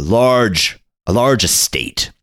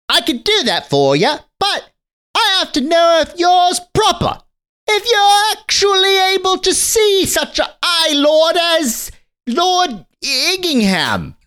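put-on much higher, prim boy's voice; snooty little boy; slower than usual. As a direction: look down your nose like a posh little boy. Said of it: "And the boy says".

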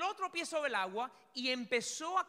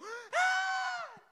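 shrill high yelp of a frightened passenger; imitated scream; 7 dB louder than usual. Mimicking a passenger's sudden scream, loud and very high. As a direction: scream like a woman when the plane drops. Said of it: "gritó como una señora en el avión".

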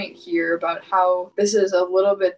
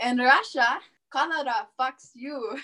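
put-on a halfhearted Russian accent, slow and dropping soft; deadpan joke in a faint Russian accent; lightly put-on Russian accent, slow; mock Russian accent; 5 semitones higher, 3 dB quieter than usual. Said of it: "in your best mock Russian accent".

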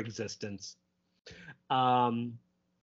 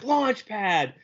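retold scream; 7 semitones higher than usual. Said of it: "and he screams".

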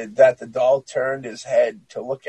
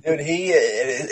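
ordinary talk

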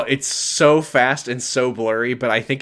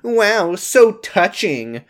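high, drawn-out fake awe; mock touched; 7 semitones higher, much slower than usual. Josh films the family's tender moment, mock gushing. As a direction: stretch it out high in mock admiration. Voicing Josh mocking while filming.

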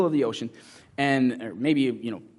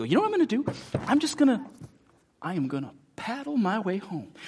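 much higher, hammed-up can-do voice; mock determination; 8 semitones higher than usual. Preacher mocks people paddling home alone, high eager voice. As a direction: play the eager self-rescuer. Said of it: "and we go".

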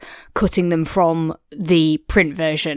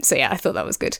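usual speaking voice

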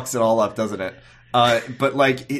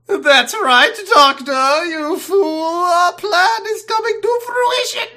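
much higher, loud gloating cackle-voice; hammy cartoon villain; 6 dB louder, slower than usual. Parodying a gloating angel taunting the Doctor, shrill and loud. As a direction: gloat like a camp cartoon baddie. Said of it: "the angels wouldn't be like".

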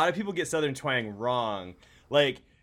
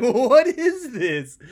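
high, slow, laughing disbelief; baffled laughing cry; 10 semitones higher, 9 dB louder, much slower than usual. Retold or imitated. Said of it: "It's like".

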